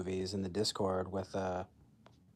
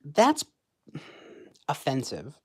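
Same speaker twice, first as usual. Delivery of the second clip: mocking outrage; 4 semitones higher, 4 dB louder than usual. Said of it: "they'll be like".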